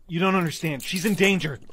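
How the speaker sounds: high, loud, urgent plea; desperate protest. Acted. John under arrest warning Maya is in danger, desperate protest. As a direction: shout a frantic warning. Arrested man pleads for her.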